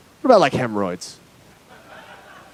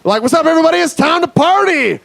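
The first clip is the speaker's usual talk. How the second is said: high-pitched party-crasher holler; rowdy shout; 13 semitones higher, 3 dB louder than usual. Retold or imitated. Nevada greets states at a party, rowdy shout. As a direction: burst in yelling, stretching the last words.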